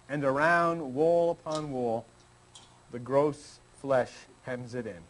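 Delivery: poem reading. Shown as a demonstration. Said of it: "He said this".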